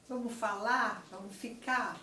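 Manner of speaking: falar and ficar end on the open vowel with no R; dropped final R. Shown as a demonstration. Teacher demonstrating casual speech: vamos fala, vamos fica. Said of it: "cai o R final".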